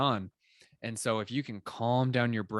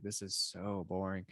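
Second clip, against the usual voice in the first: mock bored grumble, dropping softer; bored listener; 2 semitones lower, 8 dB quieter than usual. Listener losing interest in a slow speaker, bored.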